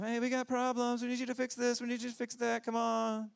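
high whiny pleading voice; needy crowd. Retold or imitated. Crowd nagging Jesus, high whiny plea. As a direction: whine like needy people wanting fixes.

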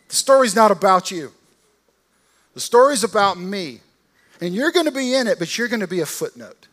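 loud, high, triumphant proclamation; Jesus telling off Pilate, loud and triumphant; bold declaration. Retold or imitated. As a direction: build it like a movie hero's last line. Jesus voiced, rising declaration.

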